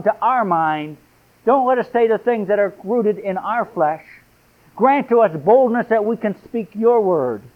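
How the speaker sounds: impassioned pulpit prayer; fervent preaching. Acted. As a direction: cry out to God with fervour. Preacher praying at full force.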